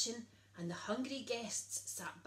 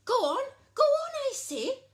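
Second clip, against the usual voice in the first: much higher, louder royal command; 13 dB louder than usual. A narrator's reading. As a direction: order it impatiently. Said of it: "Princess Lolly commanded".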